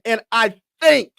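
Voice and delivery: high, loud, drawn-out player impression; Joel Embiid voice with emphasis. Star player rallying the fans, imitated loud, slow and emphatic. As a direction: punch "think" high, then slowly belt out "getting started". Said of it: "Let me say that one more time".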